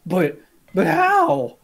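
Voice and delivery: high slow astonished gasp; shocked. Pretend. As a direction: act stunned. Mock astonishment.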